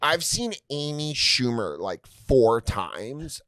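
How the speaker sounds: slow, drawn-out mock-girl voice; fan girl. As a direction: imitate a girl drawling each phrase. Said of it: "this girl walked up to me".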